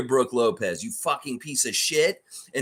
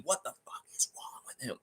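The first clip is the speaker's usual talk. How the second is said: a hushed, breathless whisper, much softer; 12 dB quieter than usual. Real, not acted. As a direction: drop it to a disbelieving whisper. Repeated rant, loud then whispered.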